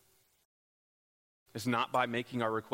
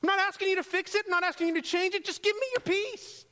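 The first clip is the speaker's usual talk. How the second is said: very high, loud shouted begging; exasperated plea; 7 dB louder than usual. Acted. Believer throwing up hands at God, exasperated shouted plea. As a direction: wail upward in hammed-up desperation. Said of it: "we throw up our hands and say".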